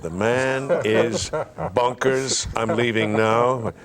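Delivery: slow, drawn-out aside; slow inner aside. Retold or imitated. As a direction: say it slowly, to yourself. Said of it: "And I said".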